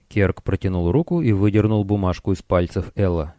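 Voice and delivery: ordinary delivery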